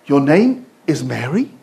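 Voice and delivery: soft, slow, stunned question; stopped mid-flow. Retold or imitated. Embassy woman suddenly softens, hearing the wife's name, slow and quiet. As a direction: let the fight drain out in wonder. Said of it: "the woman stops in mid-flow".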